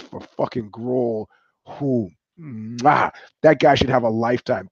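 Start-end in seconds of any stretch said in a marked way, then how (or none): none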